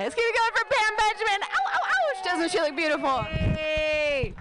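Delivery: high, fast hype-up for applause; cheering on the host. Real, not acted. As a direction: pump up the crowd. Hyping the next act.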